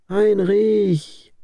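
put-on high, loud call of an old woman. A narrator's reading. Old mother calls her son home, loud high call. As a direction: call his name from down the path. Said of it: "rief eine alte Frau".

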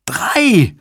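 very high-pitched scream of one word; piercing shout. A narrator's reading. Susan over sirens and shouting technicians, high scream. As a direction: cry it out over all the noise. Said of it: "schrie Susan in die Kakophonie".

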